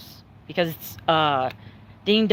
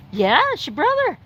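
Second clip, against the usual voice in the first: higher, sweet pet voice; dog talk; 11 semitones higher, 4 dB louder than usual. Real, not acted. Owner talking sweetly to her dog, higher pet voice. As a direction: coo to the dog about its brother.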